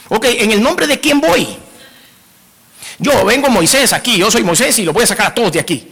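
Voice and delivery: lightly voiced Moses protesting; mock Moses. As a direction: play Moses asking who sends him. Preacher voices Moses' objection.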